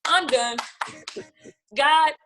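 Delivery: fed-up cry to God, mock-dramatic; retold giving up. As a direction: throw up your hands in frustration. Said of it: "And that was like".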